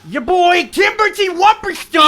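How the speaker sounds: big, high hype-man shout; announcer shout. Acted. Game master announcing the next character's turn, high excited shout. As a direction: belt out the name like a ring announcer.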